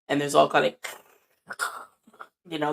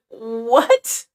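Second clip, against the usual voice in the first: loud, higher, disbelieving what; incredulous what; 6 semitones higher, 7 dB louder than usual. Retold or imitated. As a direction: throw back a stunned what. Retold reaction, incredulous.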